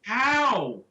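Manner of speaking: sudden high outraged yelp; indignant cry. Real, not acted. Gamer yelping back at his friends in disbelief. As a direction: shoot up high in protest.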